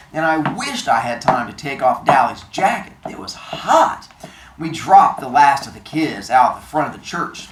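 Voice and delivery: low, measured read-aloud; story narration. A narrator's reading. Teacher reading the novel to the class, low narration.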